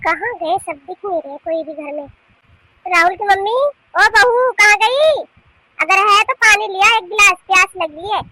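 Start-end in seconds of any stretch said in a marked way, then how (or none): 2.9-8.2 loud, high call through the house. Acted. Thirsty mother-in-law calling her daughter-in-law, loud and demanding. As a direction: holler for someone to bring water.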